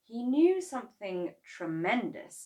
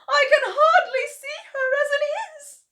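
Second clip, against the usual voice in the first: wail; 12 dB louder than usual. Acted. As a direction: draw out the wail in a high, crying voice. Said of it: "Missus Cranky wailed".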